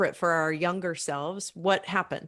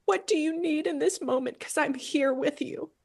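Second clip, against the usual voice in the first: gentle, higher voice to a younger self; tender reassurance; 7 semitones higher than usual. Pretend. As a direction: soothe the hurt child. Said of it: "going back to that place and saying".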